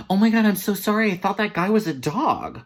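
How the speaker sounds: high, loud blurted apology; hammed-up mortified outburst; flustered apology. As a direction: blurt it out in mock panic.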